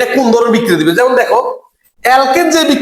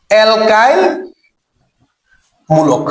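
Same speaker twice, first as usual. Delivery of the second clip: word-by-word repetition; 2 semitones lower, much slower than usual. Shown as a demonstration. Teacher drills the group's name, alkyl মূলক word by word. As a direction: split alkyl মূলক for repeating. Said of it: "কি মূলক?".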